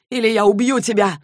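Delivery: acted angry outburst; furious threat. A narrator's reading. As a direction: threaten forcefully, pushing pitch and volume up. Furious threat during a standoff.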